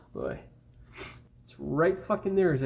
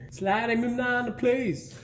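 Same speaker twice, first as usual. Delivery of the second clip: slow, deliberate mocking repeat of "Slide him in on the place"; mock repeat of own slip; 6 semitones higher, slower than usual. Host mocking his own garbled line, slow mock repeat. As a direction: drag the botched line out slowly to mock it. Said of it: "What the fuck did I say?".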